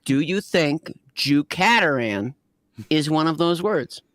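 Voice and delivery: ordinary delivery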